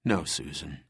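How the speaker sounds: gentle reassurance to an injured friend; gently. A narrator's reading. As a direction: ease the bad news away softly. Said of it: "he said gently".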